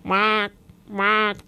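short imitated duck quacks; wild duck quack. A narrator's reading. Starling trying the wild duck's song for Babette, quacking. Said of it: "das ist das Lied der Wildente".

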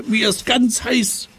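hoch und aufgebracht rufen; empörter Schrei. Acted. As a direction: genervt losschreien. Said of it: "schreit er". (Seppel schreit den Kasperl hoch und empört an)

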